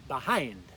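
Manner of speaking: "Behind" stressed on its second syllable, be-HIND. Shown as a demonstration. Teacher models for learners, "Behind" stressed on "hind". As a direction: punch the "hind" in "Behind", then fall. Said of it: "Accents on the second syllable".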